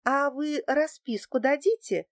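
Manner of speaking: timid, embarrassed request. A narrator's reading. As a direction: ask it a little awkwardly. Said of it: "смущённо произнесла я".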